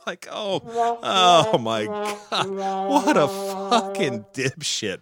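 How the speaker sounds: drawn-out scornful oh my God; slow, sneering mockery; mock disgust. Mocking Trump for drinking with two hands.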